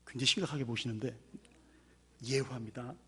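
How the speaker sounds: usual speaking voice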